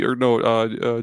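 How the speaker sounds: ordinary talk, nothing marked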